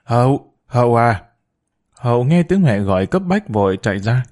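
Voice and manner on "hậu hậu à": calling the daughter from another room; gọi với. A narrator's reading. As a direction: call out her name across the house. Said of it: "cất tiếng gọi". (mẹ gọi con gái từ phòng bên, hơi lớn tiếng)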